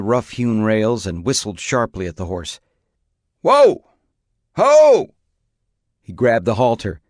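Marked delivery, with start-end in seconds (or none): 3.4-5.1 very high, very loud calls to halt; commanding shout. A narrator's reading. Man shouting to halt the rearing horse, loud commanding call. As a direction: bellow at the horse to stop.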